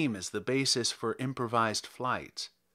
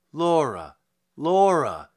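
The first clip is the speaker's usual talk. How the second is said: urgent call, louder on the second Laura; crying out; 2 semitones higher, 7 dB louder, slower than usual. Acted. Lizzie warns Laura off the goblin men, urgent cry. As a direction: call your sister back in alarm. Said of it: "cried Lizzie".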